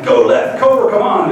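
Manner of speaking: loud, excited gaming yell; yelling at the game. Retold or imitated. Playing Modern Warfare with nephew, excited yelling. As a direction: shout orders at a teammate mid-game. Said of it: "we're both kinda yelling".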